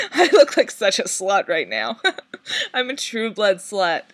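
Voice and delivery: laughing. Real, not acted. Giggling about own crushes.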